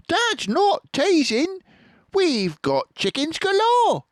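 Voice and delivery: put-on eager children insisting; excited fox cubs. A narrator's reading. Small foxes insisting.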